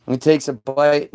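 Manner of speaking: normal talking voice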